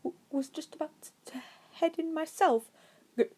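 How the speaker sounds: a timid man stumbling through; stuttering nervous. A narrator's reading. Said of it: "Despite the stuttering and faint tremble".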